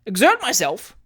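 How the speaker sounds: loud indignant echo; shouted. Acted. Trott answering the landlady's advice, incredulous shout. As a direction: bark the word back at her. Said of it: "shouted Mister Alexander Trott".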